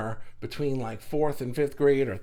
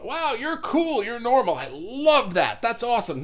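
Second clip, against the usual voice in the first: mock gushing; 9 semitones higher, 6 dB louder than usual. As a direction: gush over it like a thrilled fan, tongue in cheek. Acting out gushing admirers of normality.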